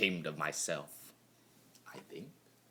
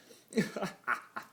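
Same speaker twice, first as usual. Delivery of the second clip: gleeful laugh; 8 semitones higher than usual. A narrator's reading. Laughing at the pony's suffering.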